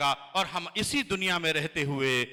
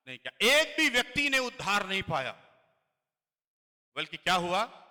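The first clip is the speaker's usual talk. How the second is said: ordinary talk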